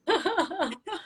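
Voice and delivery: laughing out high; bright burst of real laughter. Real, not acted. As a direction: break into laughter at the memory. Laughs after retelling daughter.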